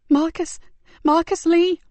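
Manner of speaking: put-on a scared boy naming his friend; frightened boy.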